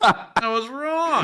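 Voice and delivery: slow, high, laughing admission. Real, not acted. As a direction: drag it out through laughter.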